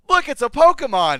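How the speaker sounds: put-on high, loud, thrilled kid yell; excited Ash shout. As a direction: shout it like an eager boy spotting a Pokemon. Retelling abridged Pokemon scene.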